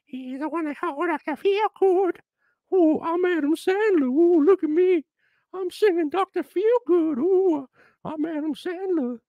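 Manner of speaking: Adam Sandler impression. Mocking the singer as Adam Sandler. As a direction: ham up a goofy Sandler croon. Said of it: "he sounds like Adam Sandler".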